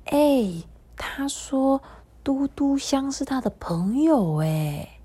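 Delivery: hushed, partly unvoiced whisper; soft whisper. Dudu Xiang murmurs happily about being a friend, soft whisper. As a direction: murmur it softly to yourself. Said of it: "他就轻声细语地说".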